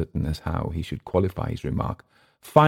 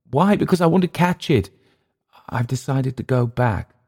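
high, emphatic answer; eager Englishman; 8 semitones higher than usual. Acted. Clift telling Emile he is leaving, eager. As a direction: burst out, then settle into the decision.

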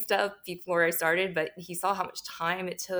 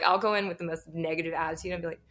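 ordinary talk

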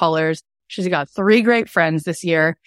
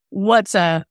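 ordinary talk

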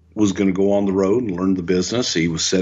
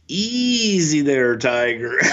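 high, drawn-out "easy there"; mock reining-in; 6 semitones higher, slower than usual. Tackling dummy to keen trainee, mock reining-in. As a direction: stretch it out high, holding back an eager rookie. Acted words to eager Shane in ring.

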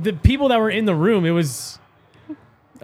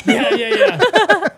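laughing; 13 semitones higher, 3 dB louder, much slower than usual. Real, not acted. Laughing at the dislike joke.

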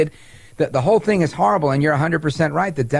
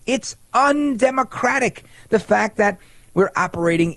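higher, slow, pouting scold; mock whine; 6 semitones higher than usual. Host mocks AOC's complaints about Democrats, light pouting whine. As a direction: mimic a sulky complaint. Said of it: "hear her complain and whine".

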